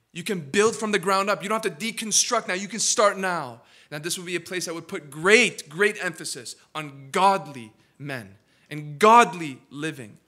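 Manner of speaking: booming fervent sermon; preaching at full force. Acted. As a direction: press the vows on the young men.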